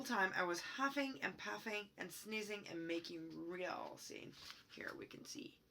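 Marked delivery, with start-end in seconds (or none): 4.7-5.6 hushed whisper pointing at the picture. Real, not acted. Aside showing picture.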